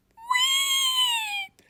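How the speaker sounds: a sudden piercing squeal, way up high; high guinea pig shriek. A narrator's reading. Guinea pig shrieking at the teacher, piercing squeal. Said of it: "Sammy shrieked".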